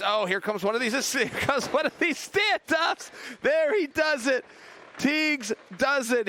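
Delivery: can't believe what he's seeing; excited. Real, not acted. Big stand-up reversal happens.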